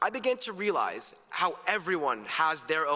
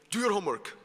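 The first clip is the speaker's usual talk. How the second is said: imitated Palestinian dad, pitched higher; Palestinian accent; 4 semitones higher, faster than usual. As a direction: give an order in Dad's Palestinian accent. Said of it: "has this distinct Palestinian accent".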